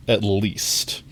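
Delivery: ordinary delivery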